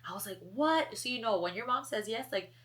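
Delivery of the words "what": loud high shocked what; stunned yelp; surprised. Retold or imitated. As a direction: blurt it out in disbelief. Shocked mom said yes.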